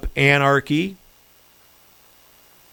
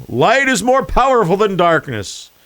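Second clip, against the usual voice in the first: mock loud Biden; 7 semitones higher, 3 dB louder than usual. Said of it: "He tries to get loud".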